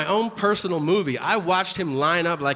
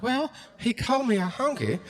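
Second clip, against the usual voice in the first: a hint of a drawling hillbilly, higher; 6 semitones higher than usual. Hillbilly voiced in the news.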